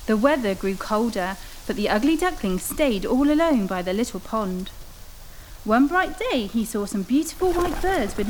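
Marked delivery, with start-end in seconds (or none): none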